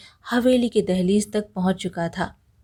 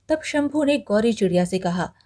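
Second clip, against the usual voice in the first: usual speaking voice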